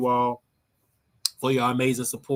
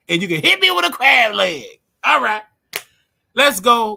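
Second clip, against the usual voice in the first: playful hype; 7 semitones higher, 8 dB louder than usual. Pretend. Joking threats at a viewer.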